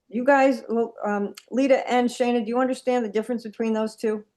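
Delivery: ordinary talk, nothing marked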